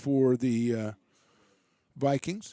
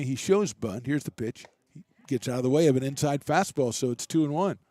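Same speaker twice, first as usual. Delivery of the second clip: brisk, lively baseball call; live play-by-play; 2 semitones higher than usual. Real, not acted. Radio announcer calling the inning for listeners, lively play-by-play. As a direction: keep the game moving for listeners.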